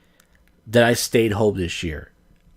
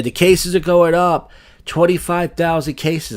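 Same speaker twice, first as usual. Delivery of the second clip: mocking the news; 7 semitones higher, 5 dB louder than usual. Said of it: "They keep saying".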